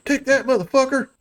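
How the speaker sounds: high-pitched combat taunt, in character; Mister Eggers. Acted. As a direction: act out a foe's high-voiced taunt. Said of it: "you recognize his voice".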